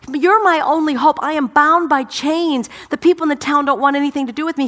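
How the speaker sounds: high, loud desperate plea of a chained man; the oppressed man begging Jesus, high and loud. Acted. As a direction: cry out to Jesus as if it's your last hope. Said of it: "he's like".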